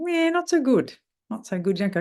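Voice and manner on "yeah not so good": high start on a bad-news answer; incongruously high, forced admission; pitched-up voice. Shown as a demonstration. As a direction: try admitting trouble in a falsely high voice. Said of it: "You can't pitch your voice and be honest".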